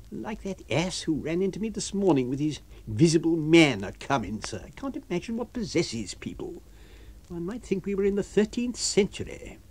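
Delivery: lightly voiced exasperated doctor; scornful doctor. A narrator's reading. Doctor scoffing alone at foolish people, scornful.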